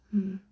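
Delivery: long pondering hum; thoughtful hmm. A narrator's reading. The Dodo ponders who won, humming. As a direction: hum while thinking hard. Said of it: "without a great deal of thought".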